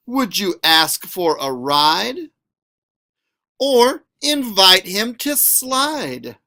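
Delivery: lively, sing-song picture-book reading; reading to children. A narrator's reading. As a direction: read it aloud to a class, playful and bouncy.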